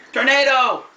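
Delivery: high, loud shout of alarm; yelling warning. Acted. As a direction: yell it out to make them run. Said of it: "points out and yells".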